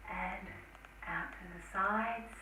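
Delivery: slow, soft guided breathing; calm breath cues, trailing to whisper; guided meditation. Acted.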